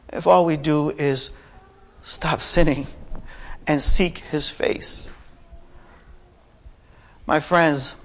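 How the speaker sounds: soft delivery with long, weighty pauses; hushed sermon appeal. Real, not acted. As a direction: gently appeal to the congregation, leaving space between phrases. Hushed appeal after the climax.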